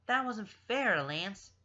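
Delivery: high, loud, sharp scolding; sharp rebuke. A narrator's reading. Pidge tells Lance off for an unfair throw, sharp. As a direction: snap it at him in reproach. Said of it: "voice far more sharp".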